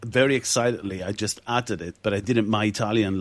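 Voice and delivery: ordinary talk, nothing marked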